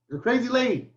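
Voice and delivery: high playful sing-song jab; teasing call. Real, not acted. Calls out to mom teasingly.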